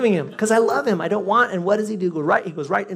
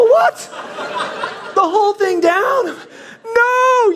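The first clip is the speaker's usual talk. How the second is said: high, loud disbelieving wail; horrified shouting; 5 dB louder, much slower than usual. Retold or imitated. Owner watches his dog swallow dead seal, horrified shouting. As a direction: cry out aghast, stretching the last word.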